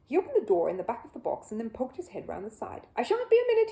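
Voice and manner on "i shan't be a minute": higher, louder cheerful call; chirpy bear call. A narrator's reading. Bear peeking round the box, calling brightly to the audience. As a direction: pop your head out and chirp.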